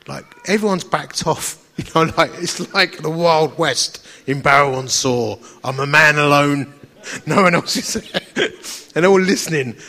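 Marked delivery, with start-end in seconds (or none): none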